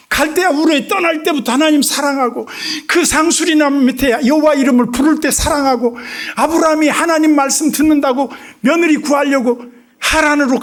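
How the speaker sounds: loud, high, driving sermon voice; fiery preaching. Acted. Preacher pressing the point to the congregation, fervent preaching. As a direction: preach it at full force.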